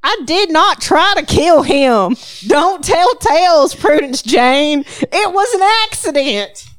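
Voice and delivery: put-on high, loud, shrieking old aunt; indignant old Southern lady. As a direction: protest your innocence loudly. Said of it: "Enter Grace".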